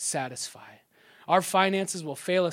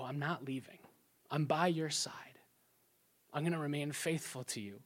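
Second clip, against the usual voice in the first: hushed, softer promise to a spouse; soft tender whisper; 3 semitones lower, 9 dB quieter than usual. Retold or imitated. Wife reassuring husband she'll stay, soft whisper. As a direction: breathe it gently like a vow.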